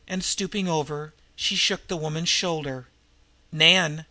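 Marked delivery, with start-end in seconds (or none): 3.5-4.1 a louder, urgent call of the name; louder repeated call. Acted. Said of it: "she repeated".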